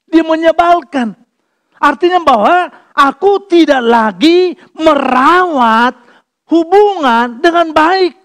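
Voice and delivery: high, loud, slow sermon climb; preaching at full force. Acted. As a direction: thunder it at the congregation.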